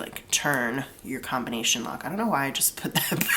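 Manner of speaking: usual speaking voice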